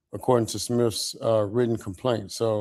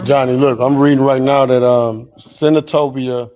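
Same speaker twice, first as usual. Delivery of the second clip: loud urgent interruption; 3 semitones higher, 11 dB louder than usual. Real, not acted. Urgently breaking in with news.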